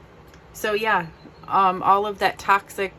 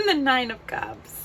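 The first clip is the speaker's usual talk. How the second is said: choked up; 7 semitones higher than usual. Real, not acted. Overcome, announcing the card.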